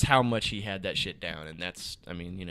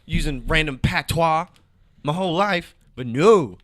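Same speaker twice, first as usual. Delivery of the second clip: loud, raised-pitch complaint; worked up and indignant; aggrieved rant; 5 semitones higher, 8 dB louder than usual. Real, not acted. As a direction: rant as the wronged original. Aggrieved rant about using patois.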